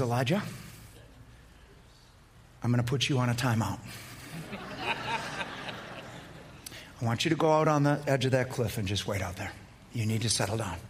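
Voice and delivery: low, soft voice of God; God speaking low and soft to Elijah; God's calm voice. Acted. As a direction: speak as a patient father.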